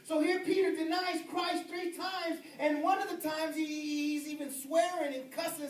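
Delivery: high, loud shouting sermon; preaching at full force. Acted. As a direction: hammer it home to the congregation.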